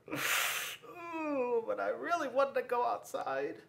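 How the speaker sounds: put-on high whining child's voice; whiny boy. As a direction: whine like a disappointed kid. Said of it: "was like".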